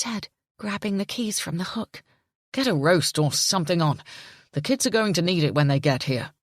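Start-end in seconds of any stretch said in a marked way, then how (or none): 2.5-6.3 lower, louder indignant male voice; furious at her accusation; angry father. A narrator's reading. Jimmy voiced.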